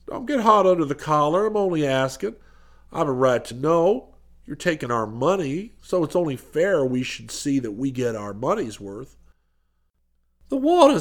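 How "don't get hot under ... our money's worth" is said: suspicious businessman. A narrator's reading. Voices businessman demanding proof.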